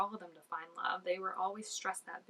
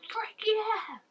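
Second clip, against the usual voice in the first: high, loud mock cheer; gleeful cheer; 14 semitones higher, 5 dB louder than usual. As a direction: squeal it like a thrilled fan. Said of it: "and she's just like".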